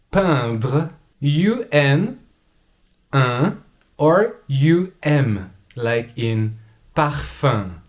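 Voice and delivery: vin, impasse, train, essaim, peindre, parfum said slowly with a nasal vowel; slow textbook pronunciation. Shown as a demonstration. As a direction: nasalize each example word slowly. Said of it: "makes the sound un".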